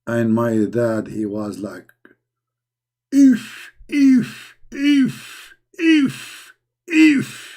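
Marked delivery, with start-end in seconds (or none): 3.1-7.5 high, short exclamations, one after another; shocked reaction. Retold or imitated. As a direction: mimic dad's stunned, high-pitched repeated yelps.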